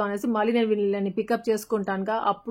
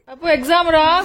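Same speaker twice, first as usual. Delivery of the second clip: excited calling out; 7 semitones higher, 8 dB louder than usual. Real, not acted. Mother greets kids back from exam.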